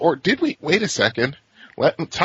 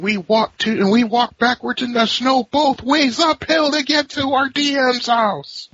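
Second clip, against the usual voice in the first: put-on old man voice; 10 semitones higher than usual. Uphill both ways old-timer joke.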